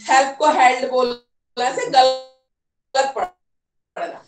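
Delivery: ordinary talk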